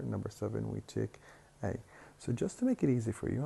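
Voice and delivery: normal talking voice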